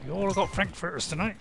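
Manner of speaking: ordinary talk, nothing marked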